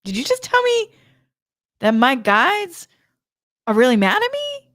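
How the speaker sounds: high, stunned question; can't believe what she just heard; shocked disbelief. Retold or imitated. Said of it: "I'm like".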